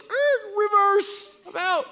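high, comic reverse call; mock retreat. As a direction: squeal like a car backing out. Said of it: "It's like".

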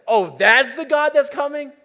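high, loud gasp of delighted surprise; excited wonder. Acted. Preacher voicing a heart that hears good news, excited. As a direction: lift it up in joyful amazement. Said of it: "your heart would be excited and say".

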